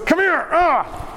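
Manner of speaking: high strained mock yank; rough grab. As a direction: play a brother tearing it away. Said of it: "It was".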